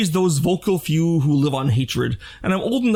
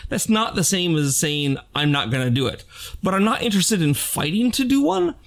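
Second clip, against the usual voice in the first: ordinary talk, nothing marked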